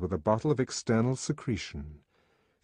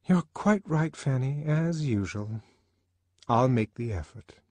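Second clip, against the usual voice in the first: lightly voiced young woman, higher; higher-pitched, dutiful agreement; conceding friend; 6 semitones higher than usual. A narrator's reading. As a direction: give in gently to a friend. Lenina gives in to her friend.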